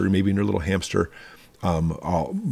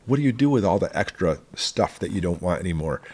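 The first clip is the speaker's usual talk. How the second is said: usual speaking voice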